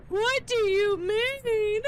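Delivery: high, loud, slow, near-crying plea; panicked cry. Real, not acted. Streamer begging chat about her missing avatar, loud tearful cry. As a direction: wail it out in disbelief. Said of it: "full-blown panic".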